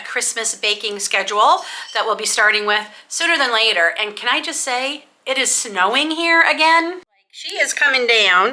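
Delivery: normal talking voice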